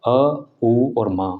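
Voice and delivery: अ, उ और मा drawn out very slowly; chant-like slow breakdown of Om; slow syllables. Shown as a demonstration. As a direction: sound out अ उ मा slowly, each held. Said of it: "ओम में तीन मात्राएं होती हैं".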